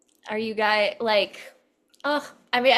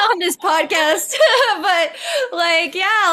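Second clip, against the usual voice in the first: laughing; 9 semitones higher, 9 dB louder, slower than usual. Real, not acted. Laughing at her own aside.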